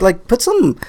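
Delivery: ordinary delivery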